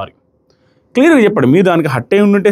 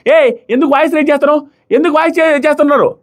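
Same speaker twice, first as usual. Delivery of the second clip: higher-pitched imitation of an angry retort; mock-heated protest at a raised voice; retold angry outburst; 8 semitones higher than usual. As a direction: replay her flare-up, pitched up. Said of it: "ఆవిడ కాలింది".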